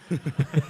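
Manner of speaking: laugh. Real, not acted. Laughs after the impression.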